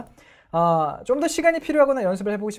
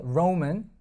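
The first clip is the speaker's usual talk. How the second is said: Roman with the first syllable RO stressed. Shown as a demonstration. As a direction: lean on RO in Roman. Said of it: "보세요".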